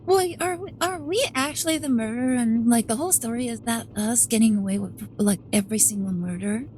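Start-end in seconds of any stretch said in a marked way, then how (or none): none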